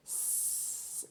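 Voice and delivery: whispered spelling. Shown as a demonstration. Teacher whispering letters while writing. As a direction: softly whisper out each letter. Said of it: "an S blend at the beginning of sleep".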